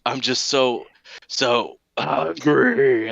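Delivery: slow, drawn-out zombie moan; a starving zombie stretching the words; hungry zombie. Retold or imitated. Zombie Peter voicing his hunger.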